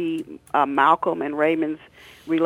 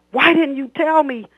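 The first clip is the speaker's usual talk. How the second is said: angry father; 11 semitones higher, 7 dB louder than usual. Acted. Father confronting son again.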